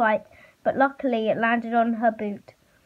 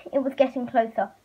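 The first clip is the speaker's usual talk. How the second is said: plain reading voice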